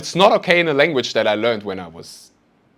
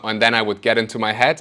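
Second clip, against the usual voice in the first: usual speaking voice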